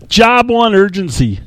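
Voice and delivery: high, booming executive proclamation; Steve Ballmer. Acted. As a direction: punch it out slowly like a hyped-up CEO. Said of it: "absolutely fantastic Steve Ballmer impression".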